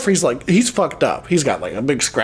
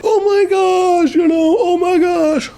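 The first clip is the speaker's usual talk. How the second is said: high, drawn-out cry of hammed-up shock; mock horror; 13 semitones higher, slower than usual. Friend retells Megan finding the dead cat, mock horror. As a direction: wail it slowly in a high pretend panic.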